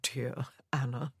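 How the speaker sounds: soft whisper, slow; murmured. A narrator's reading. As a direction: breathe her name. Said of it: "he murmured".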